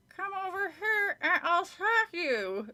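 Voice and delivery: high, slow, through gritted teeth; ventriloquist's high dummy voice; squeaky dummy voice. Acted. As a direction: speak for the puppet without moving your lips. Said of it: "trying not to move her lips".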